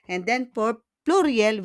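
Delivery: ordinary delivery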